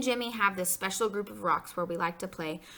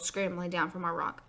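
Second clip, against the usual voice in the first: usual speaking voice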